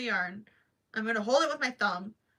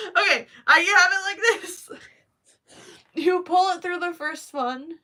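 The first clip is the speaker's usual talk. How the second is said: higher, louder words broken by real laughter; flustered laughing explanation; laughing through it; 7 semitones higher, 9 dB louder than usual. Real, not acted. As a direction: giggle while you explain. Laughing while fumbling a demo.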